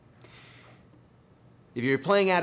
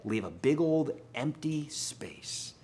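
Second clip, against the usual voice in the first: whisper; 2 semitones higher, 3 dB quieter, slower than usual. Acted. Stressing space in playing.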